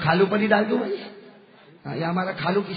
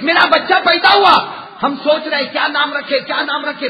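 loud, higher preaching at full force; fiery preaching; 5 semitones higher, 7 dB louder than usual. Acted. Preacher firing up the gathering about the saints, full-force preaching.

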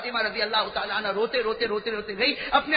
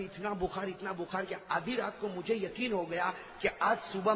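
hushed storytelling; 7 semitones lower, 10 dB quieter than usual. Acted. Soft storytelling of illness.

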